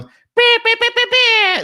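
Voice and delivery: very high, loud beeping sound effect; imitated DJ sound effect. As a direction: beep like a DJ's sound board. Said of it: "F X sound".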